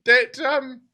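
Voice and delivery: put-on high, pleading young woman, stumbling over words; pleading captive woman.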